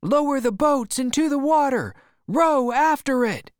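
very high, loud sustained shout; crew shouting. Acted. Crew answering the captain, high excited shouting. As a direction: yell it out as an eager crew. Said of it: "they shouted".

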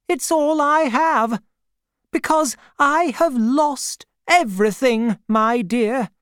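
put-on a kindly, no-nonsense old aunt greeting an old acquaintance; elderly aunt. A narrator's reading. Aunt's voice, held.

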